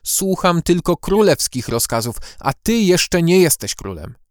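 curt, cool rebuke; firm and dry. Acted. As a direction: firmly refuse his orders. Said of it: "stanowczy i suchy ton".

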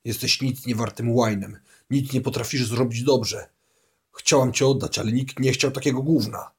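a hint of a contemptuous mother berating her son; mother's voice. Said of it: "słyszał jej głos".

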